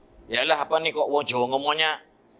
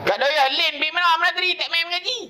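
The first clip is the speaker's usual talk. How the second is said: much higher, louder calling voice; uncle calling; 14 semitones higher, 4 dB louder, faster than usual. Retold or imitated. Uncle asking the grandmother where the boy went, high calling voice. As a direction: shout the question across to her.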